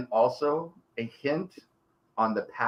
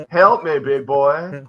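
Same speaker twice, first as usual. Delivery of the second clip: louder, hammed-up come-hither purr; seductive demon voice; 8 dB louder, slower than usual.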